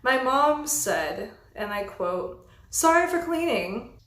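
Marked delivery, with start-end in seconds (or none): 2.7-4.0 mock apology pitched up and louder; fake-sorry jab at the roommate; sarcastic sorry. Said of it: "I was sarcastic".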